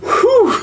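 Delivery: imitating everyone's relieved whew; mock relief. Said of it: "They're like".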